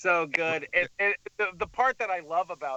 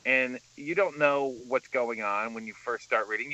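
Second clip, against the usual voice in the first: ordinary talk, nothing marked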